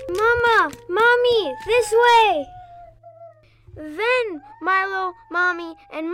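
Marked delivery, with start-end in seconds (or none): 0.1-2.5 high, loud urgent call. Acted. Child as Milo to both mothers, calling out. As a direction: call them over, punching each short phrase. Milo calls out while fleeing.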